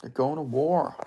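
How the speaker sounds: normal talking voice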